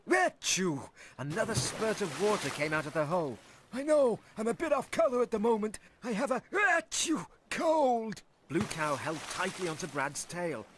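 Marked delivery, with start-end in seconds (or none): 3.7-8.2 high, fast stuffed-up whale voice; a poorly whale sniffling through a cold. A narrator's reading. Brad the whale sniffling about his cold to Blue Cow. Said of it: "sniffled Brad".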